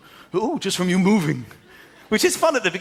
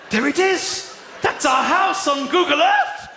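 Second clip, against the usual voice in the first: much higher, loud, slowed thrilled calling; yelling in delight for the spouse to come look; excited shout; 12 semitones higher, 3 dB louder than usual. Retold or imitated. Homeowner calling spouse to see their house online, thrilled high shout.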